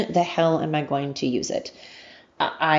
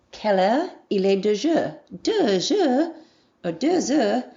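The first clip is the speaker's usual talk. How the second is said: singsong, higher schoolroom French in an American accent; terrible French accent; 5 semitones higher than usual. Shown as a demonstration. Two students in a memorized skit, butchered French accent. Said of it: "I know that my accent is terrible".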